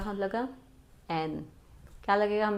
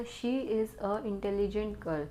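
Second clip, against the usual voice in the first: slow dictation reading; 4 dB quieter, much slower than usual. Shown as a demonstration. Teacher slowly reads an error sentence to her students, very slow and deliberate. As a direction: read the sentence out very slowly, word by word. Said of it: "इसमें आपको बताना है कि इसमें कहाँ पे कौन सा आर्टिकल".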